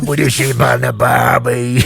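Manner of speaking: a mock Australian line, slowed and exaggerated; hammed Australian accent. Pushed by friends to say the line, a hammed Australian accent. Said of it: "Go on, say the line".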